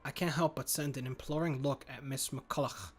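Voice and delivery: normal talking voice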